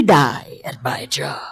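hushed urgent whisper. Retold or imitated. Parent hushing to her son about work calls, whispered. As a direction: whisper it so the call won't hear.

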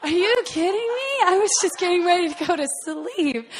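high, loud, indignant outburst; outraged disbelief. Retold or imitated. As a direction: protest loudly, pitch shooting up. Shock at five-hour layover.

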